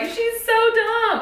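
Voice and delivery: high, slow, mock sneering voice; mocking math teachers. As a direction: drawl it out like a smug math teacher. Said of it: "all you math teachers are like".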